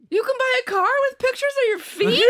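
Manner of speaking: high, laughing incredulous mother; amazed mom. Retold or imitated. Mom climbing into the new car, high laughing disbelief. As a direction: squeal the mom's disbelief through laughter. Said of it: "she's like".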